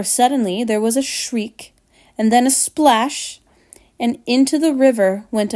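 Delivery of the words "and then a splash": plain reading voice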